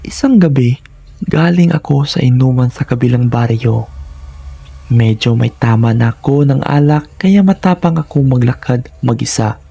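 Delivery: usual speaking voice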